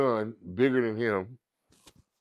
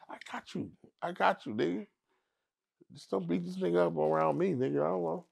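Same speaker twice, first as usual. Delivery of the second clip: higher, easy wink of a promise; Piru reassurance; 6 semitones higher, 5 dB quieter, faster than usual. Retold or imitated. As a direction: reassure your homie on the sly. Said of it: "He gives me the old Piru shit".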